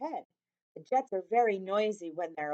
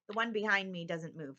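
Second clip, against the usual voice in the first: plain reading voice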